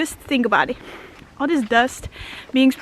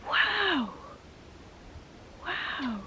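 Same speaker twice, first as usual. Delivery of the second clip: hushed awe; 3 semitones higher, 15 dB quieter than usual. Real, not acted. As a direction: marvel quietly at the bird. Awed by a passing bird.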